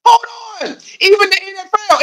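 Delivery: high, strained outcry; outraged cry. Real, not acted. Streamer crying out at the league, high and strained. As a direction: cry out in disbelief.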